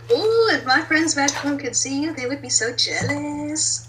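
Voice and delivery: put-on high, gushing valley girl. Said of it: "if you're talking like a valley girl".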